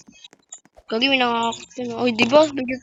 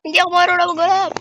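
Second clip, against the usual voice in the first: high, fast frantic protest; 7 semitones higher, 8 dB louder than usual. Real, not acted. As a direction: rush it out in a panic. Frantic about wall up.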